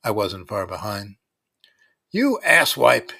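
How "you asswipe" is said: slow, loud, higher yell. A narrator's reading. Gene yells at the bully who hit a homer, slow and loud. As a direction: spit the insult out at full volume. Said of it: "yelled Gene".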